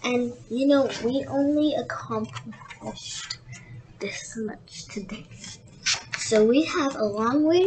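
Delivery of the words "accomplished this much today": "this much today" whispered softly; whispered aside. Real, not acted. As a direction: drop to a hushed whisper.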